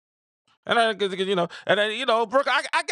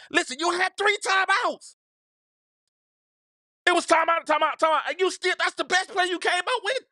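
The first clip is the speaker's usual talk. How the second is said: angry rant; 12 semitones higher than usual. Real, not acted. Ranting about timeouts.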